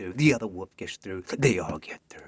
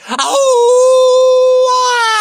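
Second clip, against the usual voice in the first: piercing shriek; 21 dB louder than usual. Acted. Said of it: "And she goes".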